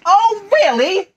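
high, loud retort; snapping Rabbit. Acted. Rabbit snapping at Tigger, high and loud. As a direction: snap it back indignantly. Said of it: "snapped Rabbit".

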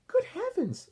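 put-on high startled old-lady voice; Grandma Thora voice. Grandma reacting to D W's spots, high and startled. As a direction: gasp it out like a fussy grandma. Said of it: "said Grandma Thora".

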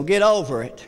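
low, curt brush-off; dismissive. Retold or imitated. Mock curt dismissal of the grieving, low. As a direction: shrug it off coldly. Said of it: "He doesn't just say".

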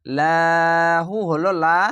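লাহু with a light, thin lam, drawn out slowly. Shown as a demonstration. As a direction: keep the ল in লাহু thin and stretch it. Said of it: "পাতলা করা হবে". (লাহু-র লাম পাতলা করে টেনে পড়া)

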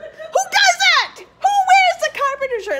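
very high, loud, incredulous yell; outraged shriek. Real, not acted. Mocking friends' Carpenters shirts, outraged shriek. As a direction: shriek the question at them.

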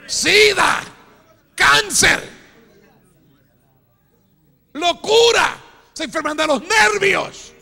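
loud, high fiery preacher cry; preaching at full force. Real, not acted. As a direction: thunder it at the congregation. Lista de castigos gritada. (predicación a todo pulmón, en voz alta y aguda)

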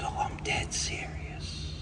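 hushed mock whisper. Designer whispering back to the hanger, earnest hush. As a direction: breathe it out, dead serious.

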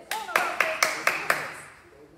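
loud, rapid counting shout; fast shouted count. Shown as a demonstration. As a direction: bark the numbers to speed them up. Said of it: "Más rápido".

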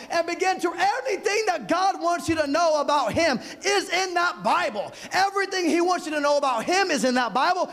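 loud, rising preacher's cadence; preaching at full force. Acted. Preacher urging the congregation to open the Bible, preaching hard.

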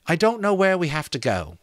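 high-pitched storybook woman; lightly acted high voice; Molly's character voice. A narrator's reading.

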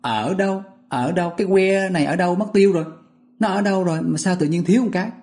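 high, fast, puzzled "where is it"; teacher acting out a puzzled search; acted puzzled asking. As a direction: search aloud for the missing stick. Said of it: "Thế là mình hỏi".

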